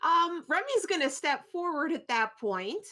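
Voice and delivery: ordinary delivery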